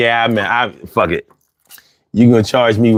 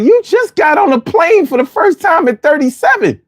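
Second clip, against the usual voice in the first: high-pitched mock disbelief; incredulous. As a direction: call out the newbie traveler in a high, incredulous voice. Mock confronting of a new traveler.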